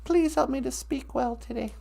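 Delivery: hushed grateful prayer. Acted. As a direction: pray quietly before speaking. Opening prayer.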